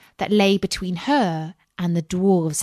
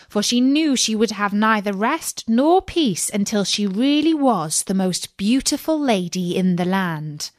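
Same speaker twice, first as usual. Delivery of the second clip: usual speaking voice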